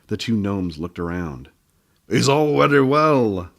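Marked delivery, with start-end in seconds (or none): 2.1-3.5 slow little gnome man voice, raised and loud; a stubborn little gnome putting his foot down; slow, insistent gnome. Acted. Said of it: "said the little man".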